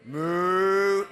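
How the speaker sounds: booming imitation of a mooing cow; loud cow moo. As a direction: give a big loud moo. Cow sound in toy game.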